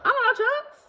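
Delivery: squeaky high confusion; puzzled squeak; very high baffled reply; mock confused. Confused retort in retold call.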